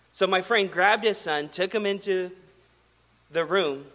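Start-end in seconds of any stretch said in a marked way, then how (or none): none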